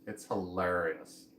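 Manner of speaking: slow, low "hilarious" stretched on LAIR. Shown as a demonstration. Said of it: "There's, like, a lair in there".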